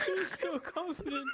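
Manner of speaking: high, shaky giggling words; crying with laughter; laughing to tears. Real, not acted. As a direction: squeeze it out through helpless laughter. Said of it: "you're making me cry".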